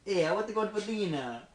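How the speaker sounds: Italian accent. Shown as a demonstration. Accent game with friends, Italian accent. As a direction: put on a lively Italian accent.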